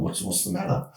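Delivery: retold, hushed and low; whispered question. Narrator whispering back to his buddy by the trees, hushed. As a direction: whisper so you don't startle it.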